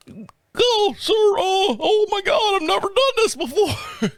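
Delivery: very high, whimpering mock panic; flustered rookie. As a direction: wail it like an overwhelmed trainee. Imitating flustered rookie Johnny.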